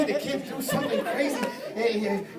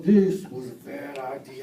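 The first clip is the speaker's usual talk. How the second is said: mock scared thought; 8 semitones lower, 3 dB louder than usual. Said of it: "my emotions go".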